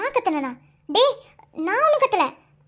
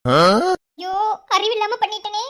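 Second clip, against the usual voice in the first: crying; 7 dB louder, much slower than usual. Acted. Scolds herself after a fall.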